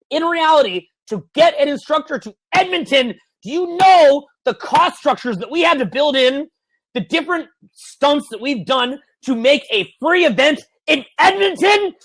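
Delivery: high, drawn-out incredulous emphasis; worked-up, straining to get the point across; exasperated rant. Real, not acted. Ranting about effort behind free events.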